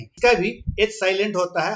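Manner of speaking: ordinary talk, nothing marked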